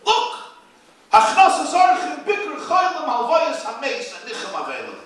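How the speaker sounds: loud, high, triumphant listing; thrilled miser shouting his haul; gleeful gloating. Retold or imitated. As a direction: crow over every mitzvah like a jackpot. Husband gloating over his bargain.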